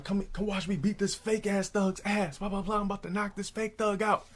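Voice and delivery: high, light imitation of fight hype; mock boasting. Rival boasting to crowd, retold.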